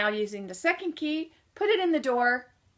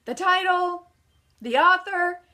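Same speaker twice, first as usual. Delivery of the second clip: ordinary talk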